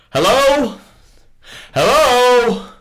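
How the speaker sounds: calling hello, waiting for a reply. Acted. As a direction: call into the empty distance. Calling into empty Antarctic cave.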